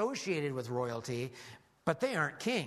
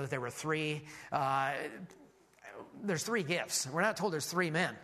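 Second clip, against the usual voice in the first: ordinary talk, nothing marked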